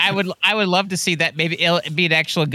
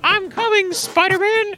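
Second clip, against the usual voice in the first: very high cartoonish call; high, put-on voice mid-flight; hero calling out; 12 semitones higher than usual. Acting out flying cardboard character.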